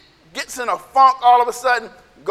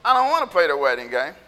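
low, quick dismissive grumble; lower-pitched, rushed refusal; sulky kid refusal; 7 semitones lower, faster than usual. Acted. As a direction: turn the game down flat. Kids refusing the game.

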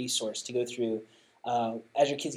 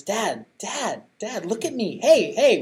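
put-on higher, louder kid calling for dad, laughing through it; little son calling; 8 semitones higher, 6 dB louder, slower than usual. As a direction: call out like a small boy who wants attention. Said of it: "he says".

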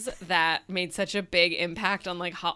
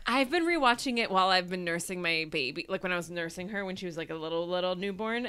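ordinary talk

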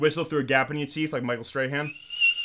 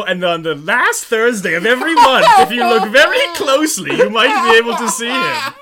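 loud, rising storyteller voice; dramatic spooky legend; 8 semitones higher, 13 dB louder than usual. Pretend. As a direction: build it up like a campfire tale.

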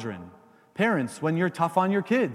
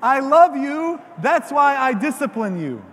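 preacher leading a call and response; loud call to repeat; 5 semitones higher, 6 dB louder than usual. Shown as a demonstration. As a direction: lead the room to shout it back. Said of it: "Say that, please".

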